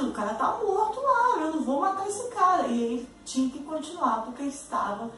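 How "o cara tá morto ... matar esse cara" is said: higher, indignant refusal, lightly acted; refusing teacher. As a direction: plead that the man is dead. (professor se recusando, voz mais aguda)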